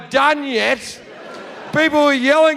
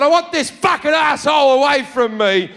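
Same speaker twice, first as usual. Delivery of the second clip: raised, higher voice in acted outrage; angry complaint; 4 semitones higher than usual. Passenger tells stewardess about his seatmate, angry complaint. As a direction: shout it at the stewardess in mock fury.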